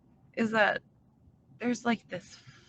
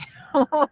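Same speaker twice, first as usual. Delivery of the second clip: loud burst of laughter; 6 semitones higher, 11 dB louder than usual. Real, not acted. Reacting to the jealous quote.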